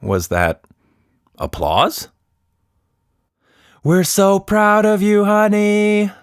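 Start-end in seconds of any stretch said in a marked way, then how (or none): 3.8-6.1 higher mother calling out the window; a proud mom calling down from upstairs; mother calling from the apartment window; mother shouting. Acted. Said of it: "Tilda's mother shouted out the window".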